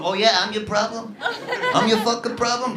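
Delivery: a mild, cool put-down; Israeli retort. Retold or imitated. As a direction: settle it calmly. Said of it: "Israeli guy".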